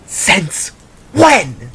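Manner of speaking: angry spat. Acted. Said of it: "Jida angrily spat".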